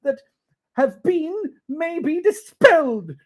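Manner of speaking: high and loud, tumbling joy; overjoyed Scrooge. Acted. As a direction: bubble over with relief.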